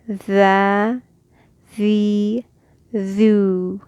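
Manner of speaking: the, the, thu held for two beats; the, the, thu elongated; two-beat vowels. Shown as a demonstration. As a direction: stretch each vowel. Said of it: "two harakat".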